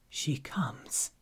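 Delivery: low, breathless whisper of a feared name; dread-filled whisper. Acted. Abbot whispers the name to Haru, fearful whisper. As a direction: barely dare to say her name. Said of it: "The abbot's whisper came threaded with dread".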